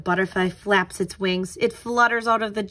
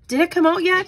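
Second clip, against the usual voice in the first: put-on high, eager kid's question, louder; eager boy; 9 semitones higher, 5 dB louder, faster than usual. Gus asks Pete about the caterpillar, high and eager. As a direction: ask like an impatient friend.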